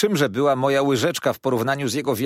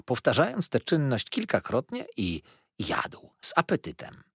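plain reading voice

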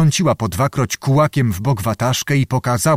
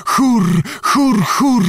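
high, sharp repeated calls; witch's high vision cry; trance cries; 11 semitones higher, much slower than usual. A narrator's reading. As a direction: cry out in a trance, pitched far up. Witch cries out inside her vision.

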